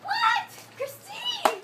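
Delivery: high, loud disbelieving what; shocked squeal. Real, not acted. Friend hears her videos are online, shocked squeal.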